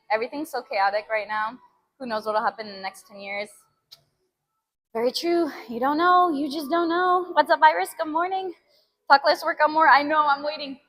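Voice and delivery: ordinary talk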